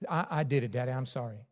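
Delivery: a hint of a sorry little son; son's quiet apology; small child admitting.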